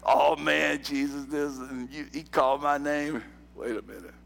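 put-on New York Italian accent, low; light New York Italian accent. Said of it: "Carmen in his New York Italian accent".

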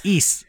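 "Is" said like "east" minus the T; long ee and final s in "Is"; game title pronunciation. Shown as a demonstration. Host corrects co-host on the game title, "Is" as "eess". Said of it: "Like you're saying east".

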